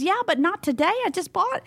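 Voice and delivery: high-pitched retold objection, laughter creeping in; imitated whiny protest. Acts out her protest, laughing.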